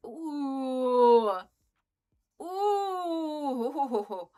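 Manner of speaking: long drawn-out, high falling ooh; big impressed ooh that swells; awed "ooh". Real, not acted. Player wowed by the vacuum upgrade, long awed ooh.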